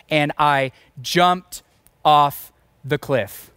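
slow, drawn-out dramatic reveal. Acted. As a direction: stretch every word out for suspense. Dramatic climax of cliff story.

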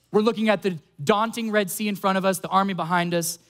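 ordinary talk, nothing marked

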